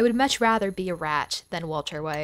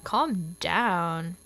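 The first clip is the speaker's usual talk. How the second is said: ordinary talk, nothing marked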